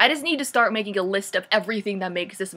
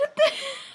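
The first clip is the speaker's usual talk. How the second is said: high laugh. Real, not acted. Mocking the book.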